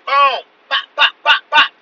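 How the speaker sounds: loud, high staccato pops; imitated gunfire. Shooter with another mag in, loud imitated gunfire. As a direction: fire off the shots with your voice.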